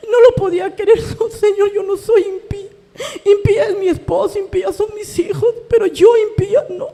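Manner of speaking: high, loud, crying protest; real tears, loud and high in denial; tearful protest. Real, not acted. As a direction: plead through sobs, voice cracking up high.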